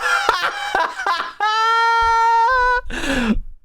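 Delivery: a big, high, cackling laugh; real helpless laugh at the official's jargon. Real, not acted. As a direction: break out laughing at the phrase. Mocking the official's phrase.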